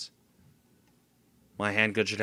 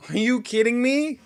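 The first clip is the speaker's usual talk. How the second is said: exasperated; 13 semitones higher, 5 dB louder than usual. Real, not acted. Gamer frustrated by failure.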